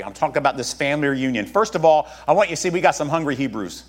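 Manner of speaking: usual speaking voice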